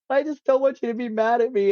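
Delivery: high-pitched mock-tearful plea; retold sobbing plea. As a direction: imitate pleading through tears. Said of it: "actually just sobbing".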